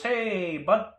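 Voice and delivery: loud, high, drawn-out hello; tow truck driver. Retold or imitated. Tow driver greeting the buyer, hearty imitation. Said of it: "He goes".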